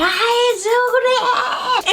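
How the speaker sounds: high, slow, wailing fake cry; mock sobbing denial. Mocking the friend who got caught, hammed-up wail. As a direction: ham up the tearful denial.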